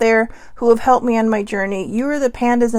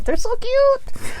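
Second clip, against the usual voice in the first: much higher, slow, melting coo; gushing over cute animals; much slower than usual. Real, not acted. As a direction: swoon over something adorable. Gushing over pandas and sloths.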